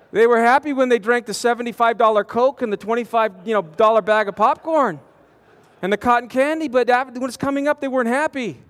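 usual speaking voice

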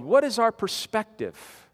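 ordinary talk

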